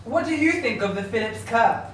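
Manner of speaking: usual speaking voice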